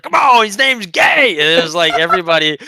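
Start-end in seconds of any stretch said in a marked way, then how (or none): 0.0-1.3 high, loud and slow jeer; mocking schoolyard shout; taunting yell. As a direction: drag it out to rile him up. Crowd trash-talking the opponent.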